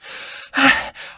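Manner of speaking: unvoiced ragged breath between words; strained breath. A narrator's reading. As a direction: catch your breath after running. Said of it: "through her still strained breaths".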